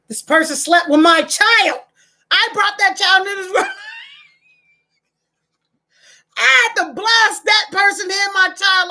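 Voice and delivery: outraged mother. Pretend. Outraged parent imagining betrayal.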